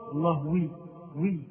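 "الله وي" with the added w glide picked out; inserted waw. Shown as a demonstration. Teacher isolating the error, "وي" tacked onto "الله". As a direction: show the stray "وي" after "الله". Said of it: "زاد واوا".

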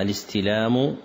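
الاستلام ending in an audible u; الاستلام with its -u ending drawn out for correction; corrected damma ending. Shown as a demonstration. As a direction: sound the final damma on الاستلام. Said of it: "صححوها".

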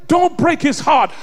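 impassioned shouted sermon; preaching at full force. Acted. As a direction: drive it home to the congregation. Preacher climax with quote.

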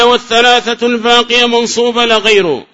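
reading out the text. Real, not acted. Teacher reads the author's next rule, declaimed. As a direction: declaim the line high and loud.

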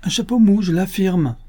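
high-pitched, adamant contradiction; emphatic assertion. A narrator's reading. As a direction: affirm it as a firm correction. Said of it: "je l'affirme, répéta madame Renaud".

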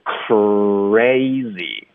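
CRAZY drawn out, "k" bursting into a rounded r; plosive with R. Shown as a demonstration. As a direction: stretch crazy slowly from the kr. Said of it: "呃，爆破音带". (慢读 crazy，k 爆入 r)

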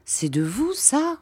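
astonished, pitch leaping high on ça; stunned question. A narrator's reading. Narrator, dazzled by the portrait, asks the deaf painter in astonishment. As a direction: gasp it out in disbelief. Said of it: "Stupidement, je lui demandais".

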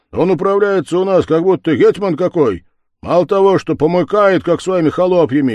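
loud, high, fast indignation; indignant tirade. Acted. Левко stirs the lads against the head, loud and fast. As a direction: stir them up against the head.

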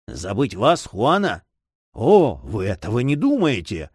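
put-on mildly pained young man's protest; a touch of grief and indignation; sorrowful outcry. A narrator's reading. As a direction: protest with wounded feeling. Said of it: "вскричал он горестно".

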